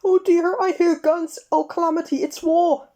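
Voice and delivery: high, loud fretful wailing; panicky wail. A narrator's reading. Mr Jelly hiding under the table, high panicked wail. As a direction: flap about in high-pitched alarm.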